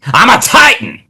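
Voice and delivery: high, hammed-up battle cry; playful shout of school pride; triumphant boast. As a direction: proclaim it high like a champion. Triumphant boast over rival schools.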